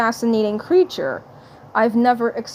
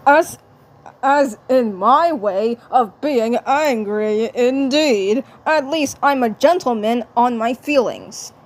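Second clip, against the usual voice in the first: Marvin the Martian. Acted. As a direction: savour the polite cartoon threat. Marvin voice again.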